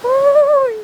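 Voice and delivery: high, loud startled yelp; a sudden fright on a swaying bridge. Real, not acted. Vlogger alone on a swaying bridge, startled yelp.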